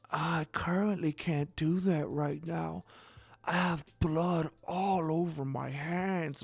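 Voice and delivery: slow, breathless hushed whisper; creepy whisper. Retold or imitated. Friend whispering to a telemarketer, slow creepy whisper. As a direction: hush it out slowly like a killer on the phone. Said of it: "she was talking like this".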